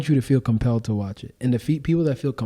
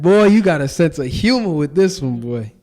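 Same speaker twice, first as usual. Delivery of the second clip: joking scold; 4 semitones higher, 6 dB louder than usual. Retold or imitated. Teasing God.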